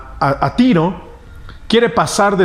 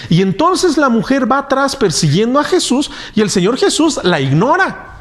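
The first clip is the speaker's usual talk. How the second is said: ordinary delivery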